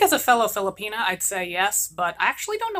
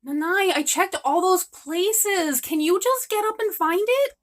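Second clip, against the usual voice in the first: higher, slow whining plea; whiny daughter; 8 semitones higher than usual. Acted. Daughter whining at her sick mother to find the spoon. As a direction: whine and beg her to get up.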